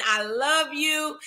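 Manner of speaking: normal talking voice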